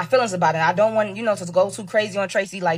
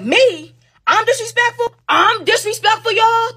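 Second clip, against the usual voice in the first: high, loud, incredulous comeback; acted-out offended protest, pitched way up; indignant; 11 semitones higher, 5 dB louder, slower than usual. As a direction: throw the accusation back in outraged disbelief. Acted argument, throwing accusation back.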